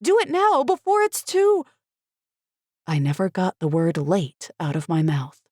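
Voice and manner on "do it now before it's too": high, loud frantic scream; desperate, cut off. A narrator's reading. Mother screaming at husband to act now, loud and high.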